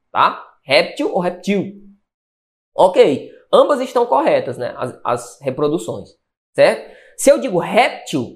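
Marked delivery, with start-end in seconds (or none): 0.6-1.7 "Réptil" stressed on RÉP, then "reptil" on TIL; first "Réptil" front-stressed, then "reptil" end-stressed; both stress patterns. Shown as a demonstration. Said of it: "réptil pode ser reptil". 7.6-8.3 "réptil" with RÉP punched, til light; first-syllable stress. Shown as a demonstration. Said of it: "eu tenho que colocar o acento".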